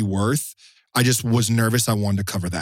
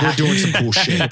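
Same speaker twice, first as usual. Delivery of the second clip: higher, louder excited quote; hyped-up; 6 semitones higher, 4 dB louder than usual. Retold or imitated. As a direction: pump up the donor's enthusiasm. Quoting generous donor hyped.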